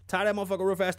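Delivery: ordinary talk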